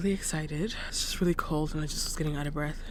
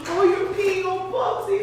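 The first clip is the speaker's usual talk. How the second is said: much higher, slow doggy talk; 6 dB louder than usual. Real, not acted. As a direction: coo at the puppy. Talking to dog.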